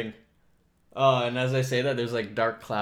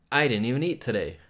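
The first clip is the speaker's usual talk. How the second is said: normal talking voice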